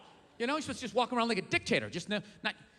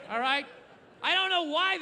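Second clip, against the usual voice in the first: normal talking voice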